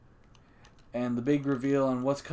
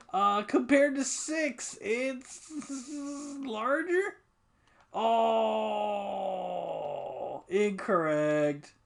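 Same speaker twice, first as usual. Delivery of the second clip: much higher, slow, airy goddess voice; 8 semitones higher, much slower than usual. Acted. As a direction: play the harvest goddess, drawn out. Voicing the harvest goddess game.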